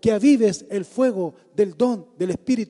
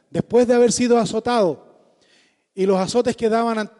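impassioned loud sermon delivery; preaching at full force; 3 semitones higher, 5 dB louder than usual. Acted. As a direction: preach it hard and fervent. (predicación apasionada a toda voz)

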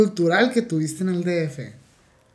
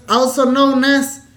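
hype announcement; 5 semitones higher, 3 dB louder, much slower than usual. Acted. Host announcing the guest's alias.

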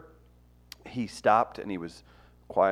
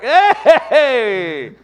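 loud, high laughing shout; 14 semitones higher, 13 dB louder than usual. Real, not acted. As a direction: yell it out with a laugh. Shouting at the camera.

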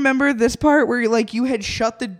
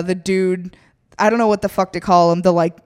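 ordinary delivery

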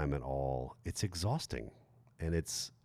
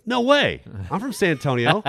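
high, loud mock amazement; excited classmate; 10 semitones higher, 7 dB louder than usual. Classmate discovering a fellow San Antonio native, excited and high. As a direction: imitate an astonished girl, pitched way up.

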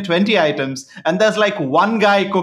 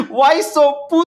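much higher, mocking Chinese accent; Uncle Roger Chinese accent; 8 semitones higher, 3 dB louder than usual. Uncle Roger mocking a customer with a nut allergy, in a high Chinese accent. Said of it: "accent he keeps doing".

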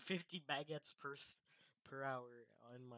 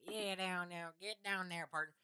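louder, higher mock hillbilly accent; 7 semitones higher, 7 dB louder than usual. Friend demonstrating hillbilly English on a podcast, hillbilly accent. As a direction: ham up a hillbilly accent like a cowboy. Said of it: "the hillbilly English".

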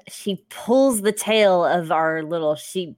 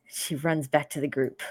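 ordinary talk, nothing marked

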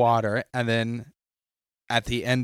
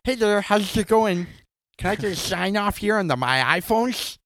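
much higher, silly lisping put-on voice; crazy lisp voice; 10 semitones higher than usual. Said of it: "you should have acted like you had a crazy lisp".

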